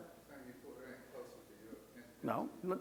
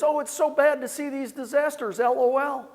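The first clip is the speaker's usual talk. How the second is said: put-on high, cheery little-old-lady voice; sweetly oblivious, higher and louder; imitating a cheerful ninety-three-year-old; chirpy old mother-in-law; 10 semitones higher, 8 dB louder than usual. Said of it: "and she goes".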